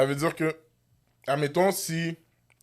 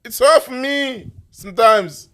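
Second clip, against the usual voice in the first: hammed-up English-speaker act, slow and higher; a showy put-on anglophone, louder than usual; mock anglophone; 10 semitones higher, 7 dB louder, slower than usual. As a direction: play the pompous anglophone, stretching every word. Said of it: "Je suis anglophone".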